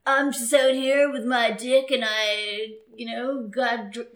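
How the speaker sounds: put-on loud, drawling male surfer accent; surfer bro. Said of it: "you yourself do not have a surfer accent".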